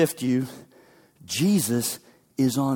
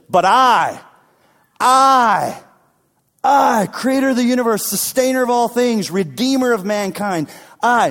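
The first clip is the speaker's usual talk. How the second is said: higher and louder on each I; emphatic I; 8 semitones higher, 5 dB louder than usual. Acted. Jesus proclaiming himself to Peter, emphatic and loud. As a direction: proclaim the emphatic I with rising force.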